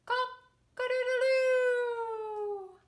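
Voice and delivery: slow, high, drawn-out crowing cock-a-doodle-doo; rooster crow. Acted. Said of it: "you can try it too".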